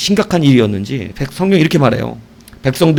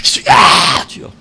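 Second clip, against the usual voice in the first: repeated cry. Shown as a demonstration. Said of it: "이렇게".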